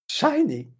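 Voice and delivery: dramatic high trailer announcer; horror trailer voice. Retold or imitated. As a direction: belt the title like a trailer. Mimicking trailer title.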